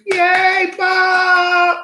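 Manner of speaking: high, bright cheer for Bob. Real, not acted. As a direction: cheer him on loudly. Cheering a viewer's milestone.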